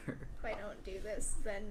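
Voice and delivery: ordinary talk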